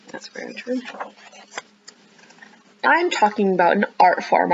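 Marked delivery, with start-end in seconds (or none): 0.1-0.8 soft unvoiced aside; whisper. Real, not acted. Reader to listening children, whispered agreement. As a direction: murmur it like a secret agreement.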